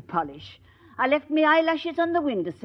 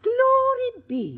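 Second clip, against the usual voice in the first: surprised; 10 semitones higher, much slower than usual. Acted. Landlady hears heiress news.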